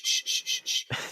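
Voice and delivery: whispered shushing noises; librarian shushing. Retold or imitated. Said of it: "the librarians do the shh".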